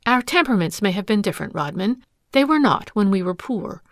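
usual speaking voice

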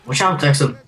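ordinary delivery

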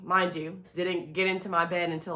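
ordinary delivery

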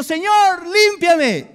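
high, loud pleading cry; crying out to the Lord. Retold or imitated. Preacher voicing David begging God to cleanse him, loud and high. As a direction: belt it out like a desperate psalm. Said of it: "Cuando David está diciendo".

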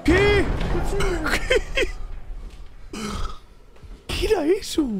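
high, startled "qué"; high shocked questions; shocked cries. Real, not acted. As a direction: gasp it out in disbelief. Sudden creature on screen.

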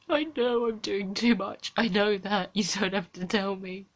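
quiet, tearful and worn out; exhausted, close to crying, barely above a murmur; near tears. Real, not acted.

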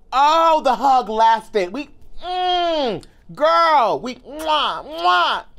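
high, drawn-out hum and smacking mwahs; swooning, stretched-out delight; rapturous relishing. Retold or imitated. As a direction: savor the hug with long squeezed sounds. Relishing and acting out the hug.

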